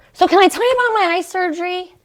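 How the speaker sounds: high, fast and whiny. Real, not acted. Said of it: "I just sound like a big whiny baby".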